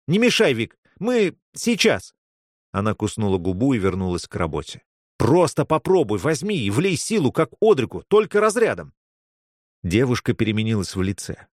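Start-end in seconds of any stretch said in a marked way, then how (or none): 0.1-2.1 high, harried reply; urgent brush-off. Acted. Girl doing resuscitation to her friend, urgent brush-off. Busy rescuer waves friend off. 5.2-8.9 high-pitched, insistent urging; urgent persuasion. Acted. Friend urging a girl to shock the patient, urgent persuasion. Urging her to use stone.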